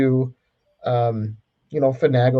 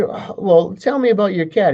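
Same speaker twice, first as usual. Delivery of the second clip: teacher voice; 7 semitones higher than usual. Acted.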